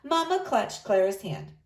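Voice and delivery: ordinary delivery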